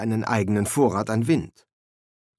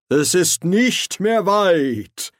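loud, high, slow call; Ridcully shouting to the wizards; 8 semitones higher, 5 dB louder, much slower than usual. Acted. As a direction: stretch out and call it across the deck. Ridcully rising, calling out.

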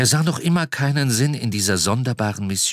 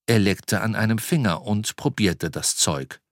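plain reading voice

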